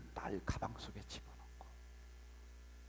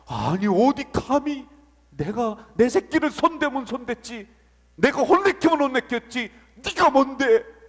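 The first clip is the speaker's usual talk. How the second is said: much louder and higher, indignant shouting; how dare you, roared at the enemy; furious rebuke; 8 semitones higher, 17 dB louder than usual. Acted. Preacher voicing God rebuking those who strike His child, furious shout. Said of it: "분노하시면서".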